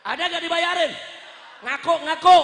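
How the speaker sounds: high, very loud demand to the crowd; challenging shout. Real, not acted.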